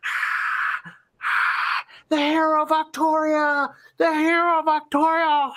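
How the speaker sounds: high chanting crowd, hailing the hero; crowd cheering. Acted. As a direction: chant his name like adoring fans. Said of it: "you can hear them cheering".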